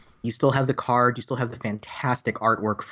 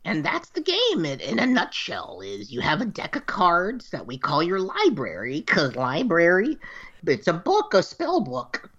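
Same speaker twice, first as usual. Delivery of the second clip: put-on high old witch mentor voice; 6 semitones higher than usual. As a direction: teach like a wise old crone. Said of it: "I'm gonna continue using the voice".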